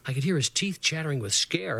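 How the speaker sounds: usual speaking voice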